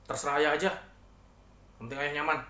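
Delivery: pouting, grudging permission; sulky. Shown as a demonstration. Wife's second answer to husband going out, sulky. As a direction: push the words out in a sulk. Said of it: "disertai dengan gestur yang cemberut".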